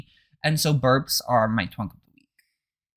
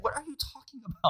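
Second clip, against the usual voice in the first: quick, soft confused mother; mock baffled mom; 9 semitones higher, 13 dB quieter, faster than usual. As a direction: toss it off fast and quiet, baffled. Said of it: "And she's like".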